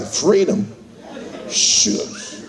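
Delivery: lightly voiced old slave, half laughing, with a whispered "Shucks"; old freed slave. Interviewed freed slave explaining why he won't leave, lightly voiced and chuckling. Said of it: "They're like".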